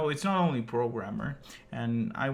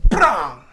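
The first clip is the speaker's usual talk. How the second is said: loud imitated bang; storyteller imitating the slammed door; door slam noise; 4 semitones higher, 15 dB louder than usual.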